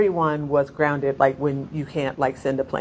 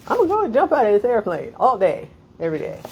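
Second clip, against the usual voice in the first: mock skydiver enthusiasm; 6 semitones higher, 4 dB louder, faster than usual. Skydivers' attitude voiced.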